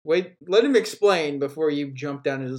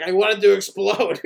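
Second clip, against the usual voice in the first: mock Elon; 2 semitones higher, 4 dB louder than usual. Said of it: "He's like".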